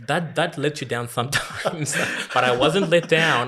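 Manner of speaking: excited. Real, not acted. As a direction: burst out laughing then insist. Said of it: "laughing, emphatic about trains".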